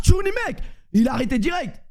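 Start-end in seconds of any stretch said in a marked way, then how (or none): 0.1-0.6 high-pitched comic comeback; retold insult. Player insulting the defender in Arabic, high comic retort. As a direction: throw the insult back, pitched way up. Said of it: "j'ai dit".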